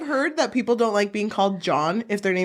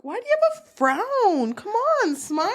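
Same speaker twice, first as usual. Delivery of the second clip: high, sing-song fake cheer; pushy holiday cheerfulness; cheery customer; 11 semitones higher than usual.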